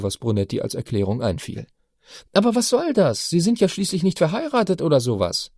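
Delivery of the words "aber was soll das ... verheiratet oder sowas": put-on high, indignant girl's voice; teenage daughter. A narrator's reading. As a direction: object like a baffled teen. Chiara argues.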